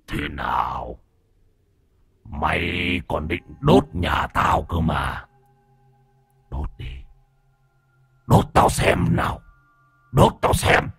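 low, slow demon voice, rasping up into a dare; taunting demon. Acted. Demon dares the boy who would burn his house, menacing taunt.

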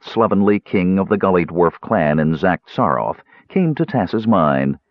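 ordinary delivery

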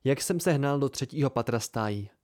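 usual speaking voice

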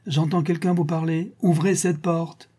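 high, insistent fatherly order; insistent demand. A narrator's reading. Baron who hears someone inside, demanding entry. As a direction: press the order, slowing on the command.

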